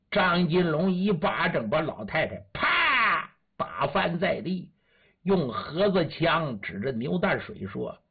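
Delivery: ordinary delivery, nothing marked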